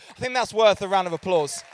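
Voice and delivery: loud cheerleading push to the room; rousing call. Real, not acted. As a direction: lift the crowd so they clap. Asks crowd for applause.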